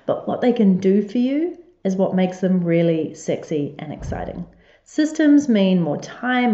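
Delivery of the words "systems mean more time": "Systems" drawn out slowly and lifted; stress on systems. Acted. Host restates her promise, stressing "Systems" slowly. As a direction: lean on "Systems" slowly, selling it. Repeats slogan from the opening.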